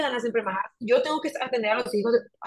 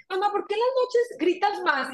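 higher, childlike voice, lightly played; child asking; 9 semitones higher than usual. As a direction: imitate a kid asking mom innocently. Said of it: "hay veces que me dicen en la noche". (hijos preguntándole a su mamá de noche, voz infantil más aguda)

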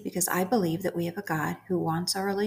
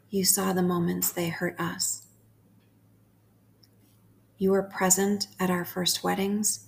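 spoken prayer. Acted. Prays over listeners slowly.

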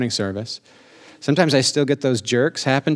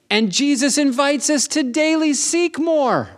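high, drawn-out preaching call; slow, sustained high cry rousing the congregation; rallying preacher's cry; 13 semitones higher, slower than usual. Real, not acted. Preacher urging the church to seek more, high rallying cry.

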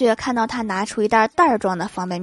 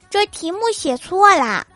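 put-on high, bright-sounding girlish voice; girlish wife; 8 semitones higher, 4 dB louder than usual. Wife tells husband the photo title is wrong, in a high girlish voice. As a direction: play the sassy young wife.

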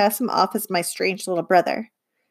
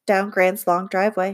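plain reading voice

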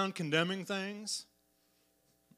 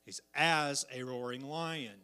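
"as" leaned on, slow and falling; slow, "as" held and stressed; 3 semitones lower than usual. Shown as a demonstration. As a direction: punch the word "as", not the lion. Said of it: "The devil is not a roaring lion".

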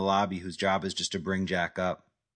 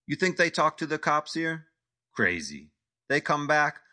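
agitated rant; 7 semitones higher than usual. Acted. Vlade ranting in accent.

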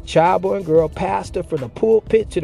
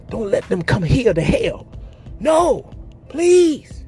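pleading cry; 6 semitones higher, slower than usual. Acted. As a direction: cry out like a soul begging not to go to hell. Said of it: "crying and begging, pleading".

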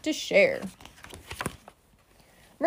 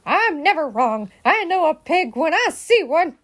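emphatic, louder, indignant scolding; bossy storybook outrage; scowling insistence; 5 semitones higher, 5 dB louder than usual. A narrator's reading. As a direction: insist crossly, punching the words. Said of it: "Eugenia scowled".

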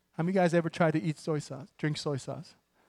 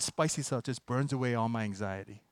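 usual speaking voice

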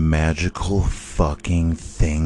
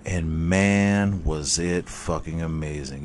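usual speaking voice

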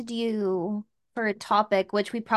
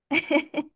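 a short burst of real laughter; bright real laugh; 7 semitones higher than usual. Real, not acted. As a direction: laugh out freely. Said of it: "you have a beautiful laugh".

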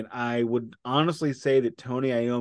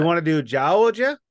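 louder, higher Georgia accent demo; Georgia Southern accent; 5 semitones higher, 6 dB louder than usual. Shown as a demonstration. Co-host shows there are different Southern accents, Georgia accent. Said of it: "there's eight different Southern accents".

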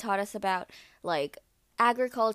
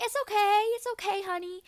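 much higher sugary brush-off; dismissive guide; 12 semitones higher than usual. Retold or imitated. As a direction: pat her off sweetly, higher. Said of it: "she was like".

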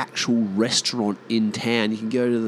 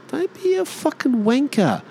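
high-pitched Aussie telling-off; high, mock-indignant reproach; scornful retort; 12 semitones higher, 4 dB louder than usual. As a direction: shoot down a pretentious mate, pitched high. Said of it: "You're like".

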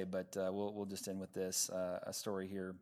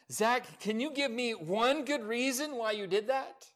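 higher and louder, breathless disbelief; gasping exasperation; 11 semitones higher, 8 dB louder than usual. A narrator's reading. Shaken father asks his son why, gasping. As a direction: demand an answer after the fall. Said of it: "I gasped in aspiration".

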